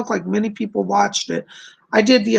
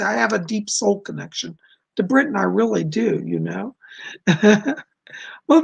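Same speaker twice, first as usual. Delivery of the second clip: usual speaking voice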